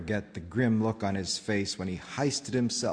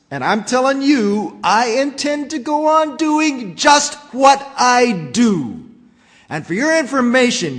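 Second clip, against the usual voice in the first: yelling; 8 semitones higher, 12 dB louder than usual. Acted. Onceler yelling back at the Lorax, loud defiant yell. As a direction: yell back, stubborn and greedy. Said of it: "I yelled at the Lorax".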